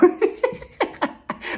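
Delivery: high breathy laugh. Real, not acted. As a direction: burst out laughing. Laughing at Ralph's answers.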